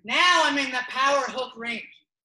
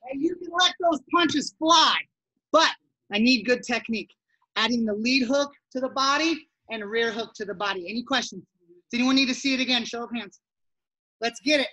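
ordinary delivery

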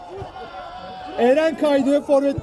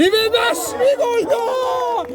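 excited goal call; 9 semitones higher, slower than usual. Real, not acted. Goal call in live commentary.

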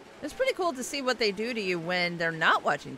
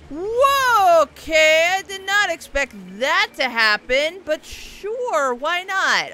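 loud, high startled whoa; surprised; 7 semitones higher, 8 dB louder, slower than usual. Real, not acted. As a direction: shout in sudden shock. Sudden unexpected event in game.

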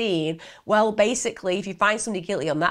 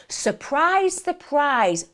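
mock-amazed, much higher on the first; sing-song sarcastic reveal; sarcastic surprise surprise; 7 semitones higher, much slower than usual. Real, not acted. Narrator mocking the witness's sudden memory, sarcastic sing-song.

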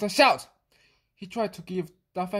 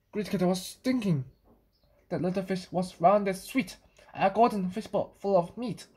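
ordinary delivery

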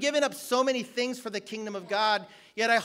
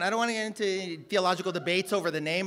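usual speaking voice

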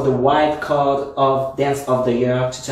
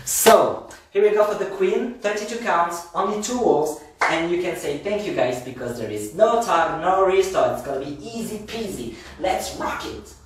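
higher, upbeat class-leading voice; rally the class to start dancing; line dance teacher; 6 semitones higher than usual. Acted. Instructor to online dancers, higher and upbeat.

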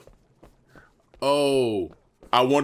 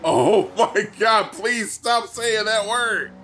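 high, loud, slow begging; exasperated plea; 9 semitones higher, 4 dB louder, slower than usual. Real, not acted. Player begging partner to stop the word, slow loud exasperated plea. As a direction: drag it out in exasperation.

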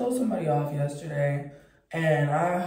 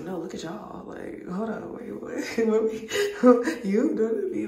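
flustered laughing reply; 6 semitones higher, 4 dB louder than usual. Retold or imitated. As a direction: giggle through it, caught off guard. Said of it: "I was like".

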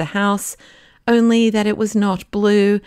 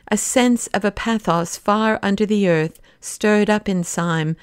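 plain reading voice

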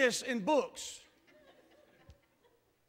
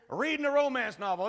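usual speaking voice

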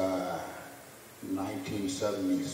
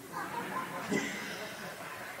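high breathy laugh; 10 dB quieter than usual. Real, not acted. Teller laughs at Ali's refusal.

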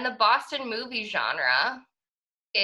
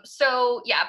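normal talking voice

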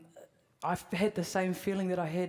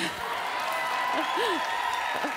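laughing; 8 semitones higher than usual. Real, not acted. Laughs at the Matildas question.

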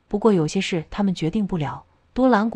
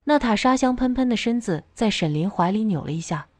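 plain reading voice

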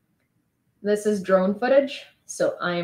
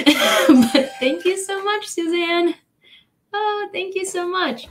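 thanks bubbling over with real laughter, pitched up; laughing thanks; 9 semitones higher, 4 dB louder, slower than usual. Real, not acted. Thanking a viewer for a donation, laughing. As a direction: giggle through the thank-yous.

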